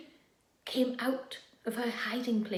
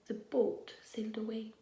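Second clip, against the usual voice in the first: whispered letdown; 3 semitones lower, 9 dB quieter than usual. A narrator's reading. Boat leaves again.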